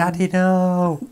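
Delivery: hammed-up childish whine; 1 mock child plea. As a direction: beg like a bratty child. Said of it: "it was just like".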